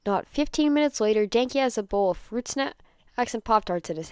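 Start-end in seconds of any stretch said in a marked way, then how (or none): none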